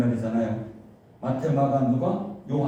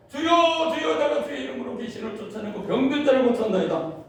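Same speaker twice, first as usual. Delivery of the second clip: boastful pleading; 13 semitones higher, 4 dB louder than usual. Acted. Quoting those who cry Lord Lord.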